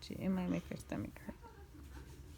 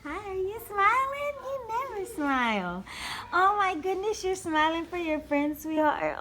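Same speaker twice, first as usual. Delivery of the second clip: much higher, louder delighted cooing; delighted gushing; 8 semitones higher, 8 dB louder than usual. Real, not acted. Mother thrilled her baby smiles for friends, loud and high.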